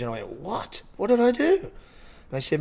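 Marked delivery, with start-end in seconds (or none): 0.4-1.7 high, mildly incredulous question; retold bafflement. Friend retells learning he's on the front page, baffled. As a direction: voice jumps up in puzzled surprise. Acts own baffled reaction to news.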